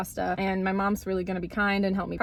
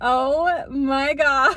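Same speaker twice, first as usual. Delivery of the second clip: drawn-out oh my God; 4 semitones higher, 6 dB louder, much slower than usual. Retold or imitated. Reacting to her notification.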